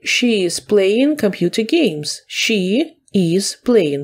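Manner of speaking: slow, careful model reading; slow dictation. Shown as a demonstration. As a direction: read it slowly for students to copy. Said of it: "Далі". (повільно, чітко для учнів)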